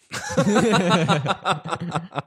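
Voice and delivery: high, cracked-up laugh; real helpless giggling; burst of laughter. Real, not acted. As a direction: crack up at your own joke. Cracks up at own quote.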